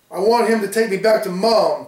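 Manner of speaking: put-on loud, high, tearful child's shout; little boy shouting at his sister, in tears. A narrator's reading. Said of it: "Paul shouted".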